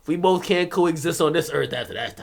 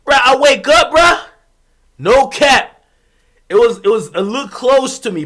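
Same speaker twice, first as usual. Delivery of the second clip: louder, higher excited telling; 6 semitones higher, 8 dB louder than usual. Real, not acted. Recounting waking to the prank, excited and loud. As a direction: build the shock of waking.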